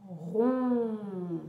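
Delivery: "rond" drawn out very long; stretched vowel. Shown as a demonstration. Teacher showing a child the round mouth, stretched vowel.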